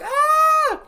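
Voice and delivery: high piercing scream of fright. Retold or imitated. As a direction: shriek at the killer. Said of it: "She's like".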